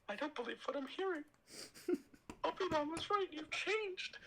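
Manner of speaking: high breathy falsetto Padme, swooning; hammed-up high Padme voice. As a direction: squeal her name in a high falsetto. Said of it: "my Padme is unmatched".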